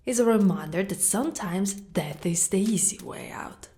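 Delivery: normal talking voice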